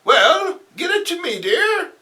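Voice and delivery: very high doting aunt voice; old aunt. Acted. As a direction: coax him gently, dear. Aunt replies.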